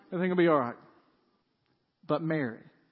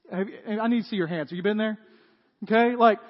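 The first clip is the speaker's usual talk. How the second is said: ordinary talk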